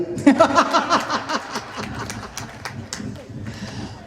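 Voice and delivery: long high cackling laugh, trailing away. Real, not acted. Storyteller tickled by own beer line, long high laugh. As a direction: burst into rapid, high laughter that slowly dies down.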